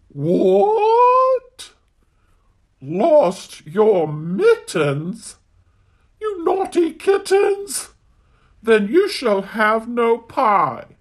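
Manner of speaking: a mother cat aghast at naughty kittens; scolding mother cat. Acted. Mother cat scolding kittens for lost mittens, high and shocked.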